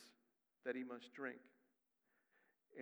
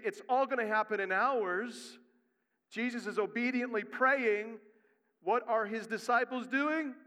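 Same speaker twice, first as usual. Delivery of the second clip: loud, high preacher's push; loud sermon delivery; full-force preaching; 7 semitones higher, 9 dB louder than usual. Acted. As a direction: press the point on the congregation. Preaching to the congregation.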